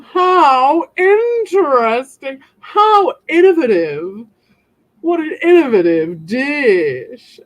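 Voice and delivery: sarcastic fine-dining praise; posh critic. Pretend. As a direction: savour each word like a snooty judge. Mock posh food critic.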